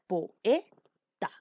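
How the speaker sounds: "Poeta" split into po-e-ta; syllable by syllable. Shown as a demonstration. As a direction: say "Poeta" in three separate beats with pauses. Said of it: "no pueden ir juntas".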